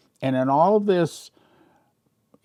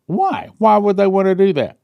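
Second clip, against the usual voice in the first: high, louder disbelieving question; indignant why; 7 semitones higher, 5 dB louder than usual. Real, not acted. Reacting to water limits on washers, louder indignant disbelief. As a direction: demand an answer, outraged.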